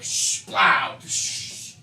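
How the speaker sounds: breathy shh and plow of a bike crashing; whispered crash noise. Retold or imitated. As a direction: make the bike crash in a hushed hiss.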